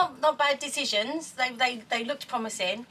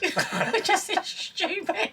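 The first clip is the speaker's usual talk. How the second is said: high, drawn-out words through laughter; laughing; 9 semitones higher, much slower than usual. Real, not acted. Partner joking about the couple's own blunders, laughing. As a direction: laugh helplessly as you say it, stretching it out.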